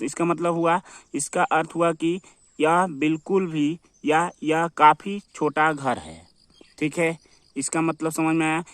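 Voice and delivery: normal talking voice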